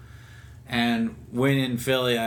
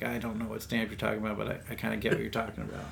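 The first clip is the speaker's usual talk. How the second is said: softer, fast mumbled reply; sheepish retort; 8 dB quieter, much faster than usual. Retold or imitated. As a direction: toss it off quickly under the breath. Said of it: "And I was like".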